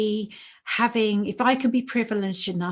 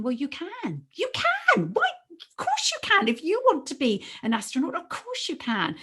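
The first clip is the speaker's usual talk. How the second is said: passionate encouragement; 8 semitones higher than usual. Retold or imitated. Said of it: "I'm there saying".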